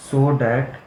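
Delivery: very slow, word-by-word dictation. Acted. Teacher dictating the problem statement to the class, very slowly. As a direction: dictate so students can write it down.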